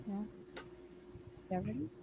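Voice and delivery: normal talking voice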